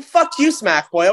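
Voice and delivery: normal talking voice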